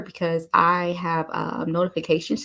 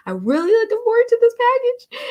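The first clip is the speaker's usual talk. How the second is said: put-on much higher, louder kid-like squeal of eagerness; imitating an eager child begging for a toy; childlike excitement; 6 dB louder than usual. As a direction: sing it out like a kid before Christmas. Like a kid wanting ice cream.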